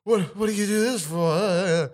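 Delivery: panting between words; breathless from running to the car; out of breath. Retold or imitated. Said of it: "I'm just still panting".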